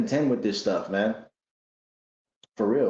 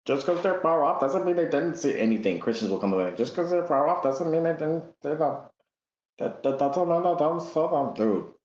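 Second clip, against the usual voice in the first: higher-pitched mock objection; mocking imitation; 6 semitones higher than usual. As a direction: parrot a dumb argument, pitched up. Mocking an opponent's counter-argument.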